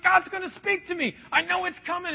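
high-pitched, eager imitation of a believer; eager anticipation. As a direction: act out breathless-with-hope expectation, pitched high. Voicing Elijah's eager expectation.

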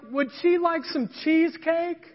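eager manager. Retold or imitated. Manager's eager offer retold.

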